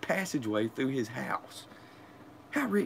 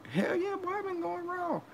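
much higher, squeaky bragging voice; squeaky mock brag; mocking friend; 3 dB quieter than usual. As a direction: mimic the dumb friend bragging. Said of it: "Oh, he said".

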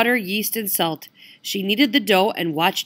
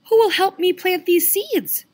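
high, louder hen voice; little red hen voice; 12 semitones higher, 4 dB louder than usual. Acted. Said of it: "she asked".

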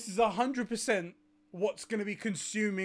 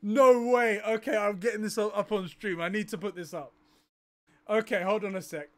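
normal talking voice

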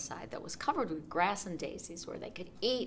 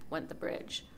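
usual speaking voice

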